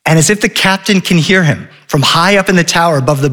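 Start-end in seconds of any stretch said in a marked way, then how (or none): none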